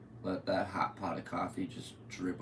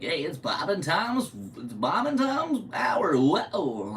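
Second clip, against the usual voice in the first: much higher, louder, slow hammed-up radio host; radio show jingle; 10 semitones higher, 8 dB louder, slower than usual. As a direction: belt it like a cheesy morning show intro. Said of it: "a morning radio show called, like".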